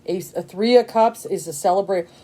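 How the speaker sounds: usual speaking voice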